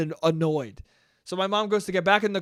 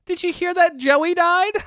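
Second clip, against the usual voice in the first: very high, chirpy mock-mom voice; put-on high mom voice with a little laugh; mom chatting; 11 semitones higher, 3 dB louder than usual. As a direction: imitate a gossiping mother, pitched way up. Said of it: "And mom's like".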